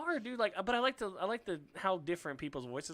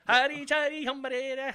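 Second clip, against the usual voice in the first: high, slow fake Hindi gibberish; mock Bollywood accent; 10 semitones higher, 5 dB louder than usual. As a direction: chant fake Hindi slowly.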